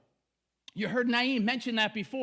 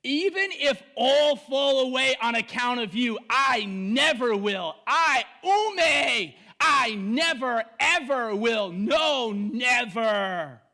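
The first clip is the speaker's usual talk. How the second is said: louder, insistent, leaning on never; emphatic vow; 4 dB louder, slower than usual. Retold or imitated. Peter vowing loyalty to Jesus, insistent and loud. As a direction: swear it like you mean it. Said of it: "Peter replied".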